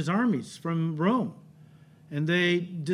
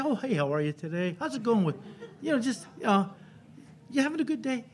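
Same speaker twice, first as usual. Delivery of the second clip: casual chitchat; 4 semitones higher, 6 dB quieter, faster than usual. Pretend. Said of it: "You don't say".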